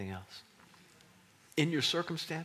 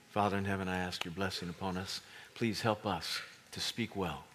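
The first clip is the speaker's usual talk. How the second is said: prayer voice; 5 semitones lower than usual. Acted. Leads closing prayer.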